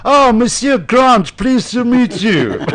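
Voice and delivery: high, loud, slow hammed-up Frenchman; effusive French accent. Frenchman pleased to meet Monsieur Grant, effusive French accent. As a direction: gush it slowly in a mock French accent.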